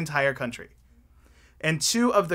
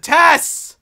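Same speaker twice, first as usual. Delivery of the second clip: much higher, louder demanding questions; had enough; 8 semitones higher, 7 dB louder than usual. Acted. Tyson demanding answers from Tess, much higher and louder. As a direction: burst out in exasperation. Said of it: "he had finally had enough".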